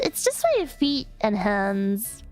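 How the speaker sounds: ordinary delivery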